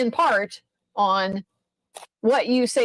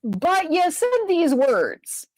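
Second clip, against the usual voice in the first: slow, higher, deliberate mock rebuttal; imitating Joe's pointed reply; 6 semitones higher, slower than usual. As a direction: lawyer Joe pressing the witness, pointedly. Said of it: "He's like".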